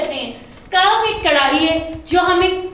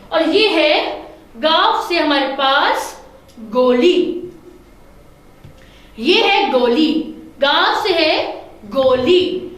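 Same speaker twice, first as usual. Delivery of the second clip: slow teacher emphasis; much slower than usual. Shown as a demonstration. Teacher pointing out a picture.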